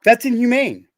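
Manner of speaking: slow, forceful indignation; outraged. Real, not acted. Survivor condemning child abusers to another guest, outraged. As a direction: drive each word home in anger.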